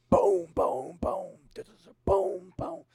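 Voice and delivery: loud, booming slam noises; imitated door slams. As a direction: boom out doors slamming one after another. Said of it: "like, slamming".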